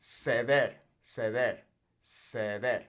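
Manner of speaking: ceder said with a soft th-like c, slowly repeated; Spanish soft C. Shown as a demonstration. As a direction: model ceder clearly for learners. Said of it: "First off, we have ceder".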